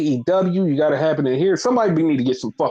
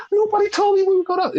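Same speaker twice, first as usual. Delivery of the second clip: much higher, fast, put out; squeaky complaint, rushed; higher, faster imitation of Io asking why she wasn't told; mock Io; faster than usual. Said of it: "She's like".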